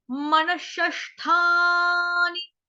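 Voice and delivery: मनशष्ठानी drawn out, विसर्ग as श; slow model word. Shown as a demonstration. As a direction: stretch मनशष्ठानी syllable by syllable. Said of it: "विसर्ग का उच्चारण आधे षटकोण वाले श से". (मनशष्ठानी धीरे, विसर्ग श जैसा)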